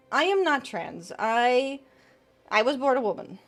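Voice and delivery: ordinary talk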